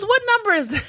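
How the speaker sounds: very high, loud imitated query; nosy blurted question. Patient pestering others about the movie, loud high imitation. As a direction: butt in with a high-pitched question. Retold woman blurting again.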